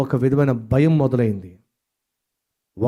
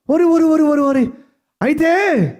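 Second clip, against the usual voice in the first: mocking gossip; 4 dB louder than usual.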